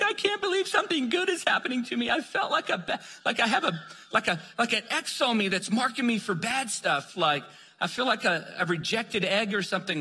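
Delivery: high, tearful disbelief in her voice; overwhelmed woman. Retold or imitated. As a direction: voice her like she's stunned and hurt. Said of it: "she's like".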